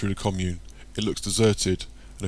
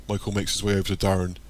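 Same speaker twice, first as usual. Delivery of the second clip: ordinary delivery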